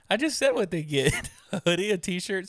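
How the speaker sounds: real laughing exasperation; laughing protest. Real, not acted. Laughing protest at being asked again.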